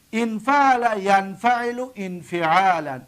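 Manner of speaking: slow, deliberate paradigm reading; slow recitation. Shown as a demonstration. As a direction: recite slowly so students follow. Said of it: "دقت بتید". (آهسته و شمرده)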